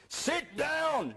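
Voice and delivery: high-pitched yell imitating an irate judge; angry judge yelling. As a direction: bark it like a judge losing his temper. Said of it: "the judge got so angry".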